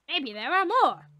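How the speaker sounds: usual speaking voice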